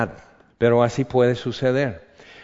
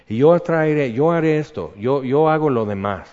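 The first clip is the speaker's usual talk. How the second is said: higher, louder commanding divine promise; a hint of a booming God, raised and louder; 5 semitones higher, 5 dB louder than usual. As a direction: proclaim it as God reassuring his servant. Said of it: "ella dice".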